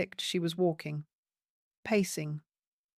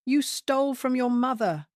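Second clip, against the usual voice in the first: high, loud shout; 6 semitones higher, 5 dB louder than usual. Acted. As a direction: shout it back at him. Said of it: "Sienna shouted back".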